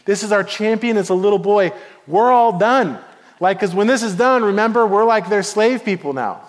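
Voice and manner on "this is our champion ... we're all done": higher and louder, panicked disbelief; imitating a stunned crowd crying out; Israelites in dismay. Watching soldiers seeing a boy as champion, alarmed and loud. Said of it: "probably the Israelites watching going".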